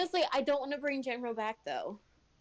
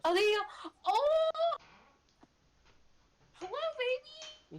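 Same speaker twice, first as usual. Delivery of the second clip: cooing at a kitten. Real, not acted. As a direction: gush over a cute kitten.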